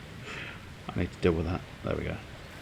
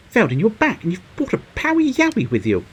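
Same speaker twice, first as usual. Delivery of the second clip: high, louder delighted greeting; excited game character; 13 semitones higher, 5 dB louder than usual. Acted. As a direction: greet a returning friend with high-pitched excitement. Voices game character greeting the player.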